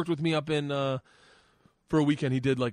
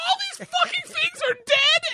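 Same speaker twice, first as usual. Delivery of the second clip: much higher, louder despairing shriek; hysterical wail; 7 dB louder than usual. Retold or imitated. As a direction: lose it completely. Daughter losing it.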